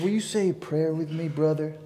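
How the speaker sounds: easygoing hippie asking a favour, lightly done; lightly voiced hippie. Storyteller voicing the hippie at the diner, mellow and friendly.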